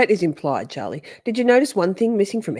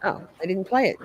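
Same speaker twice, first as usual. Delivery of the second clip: normal talking voice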